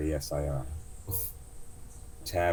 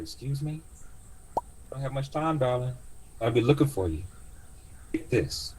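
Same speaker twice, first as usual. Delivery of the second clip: much higher, urgent auntie; old cab driver; 8 semitones higher than usual. Acted. Driver hurrying passenger with a crystal, high urgent voice. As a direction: rush him like a worried old driver.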